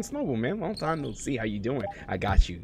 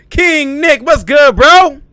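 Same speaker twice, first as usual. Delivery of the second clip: loud greeting; 15 dB louder, much slower than usual. Real, not acted. Calling out greeting to a player.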